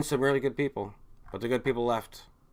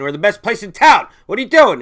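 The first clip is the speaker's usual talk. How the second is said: loud, high, outraged pizza-shop owner; indignant boss shout; 8 semitones higher, 13 dB louder than usual. Retold or imitated. Angry owner shouting down his young staff, loud and indignant. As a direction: yell it like a furious owner. Owners arguing against workers unionizing.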